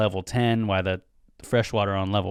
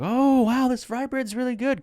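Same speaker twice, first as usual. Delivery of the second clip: very high, sugary party praise; fake polite gush; 14 semitones higher than usual. Guests at a party praising fry bread, fake high gush. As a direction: gush over the food like you don't mean it. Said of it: "everyone's like".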